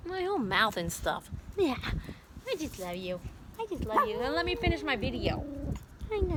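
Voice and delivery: cooing, swooping pet voice. Real, not acted. Owner to dogs licking her face, affectionate baby talk. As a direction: gush lovingly at a dog smothering you.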